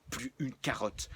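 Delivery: ordinary delivery, nothing marked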